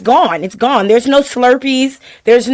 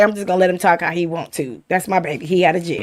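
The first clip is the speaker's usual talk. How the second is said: softer, lower imagined mother's voice; indulgent mama; 4 semitones lower, 7 dB quieter, faster than usual. Retold or imitated. Mama privately humoring her son out of jail, quiet and indulgent. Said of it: "She's just like".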